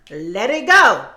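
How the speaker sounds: usual speaking voice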